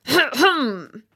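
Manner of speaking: loud, high "ahem"; pointed throat-clearing cough. A narrator's reading. Rocket trying to cut in, pointed cough. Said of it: "he began to cough".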